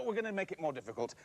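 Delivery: ordinary delivery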